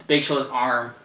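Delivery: ordinary talk, nothing marked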